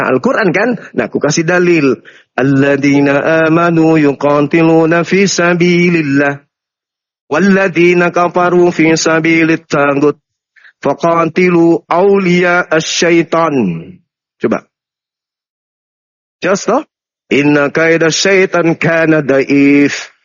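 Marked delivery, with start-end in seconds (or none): 2.3-13.5 slow, low measured Arabic recitation; Quran recitation. Acted. Debater giving scriptural proof to his challenger, slow low Quran recitation. 17.3-20.1 slow, low measured Arabic recitation; Quran recitation. Acted. Debater giving scriptural proof to his challenger, slow low Quran recitation.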